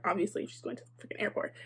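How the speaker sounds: fast, breathy sarcastic aside; whispered aside. Real, not acted. Telling listeners, a quick whispered sarcastic aside. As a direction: mutter it fast under your breath.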